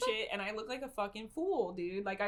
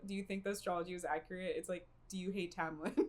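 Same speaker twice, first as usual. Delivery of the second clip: ordinary talk, nothing marked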